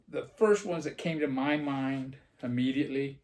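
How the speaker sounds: normal talking voice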